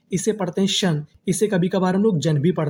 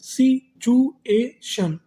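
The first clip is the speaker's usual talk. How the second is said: Situation stretched very slowly, higher, syllable by syllable; slow drawn-out reading; 5 semitones higher, much slower than usual. Shown as a demonstration. Teacher sounding out a new word slowly for students, higher and drawn out. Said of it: "तो meaning क्या बनी हमारी?".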